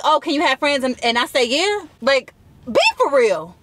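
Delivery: ranting at the guy who asked for her number; heated rant. Real, not acted. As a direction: call him out hard. Venting, arguing at absent guy.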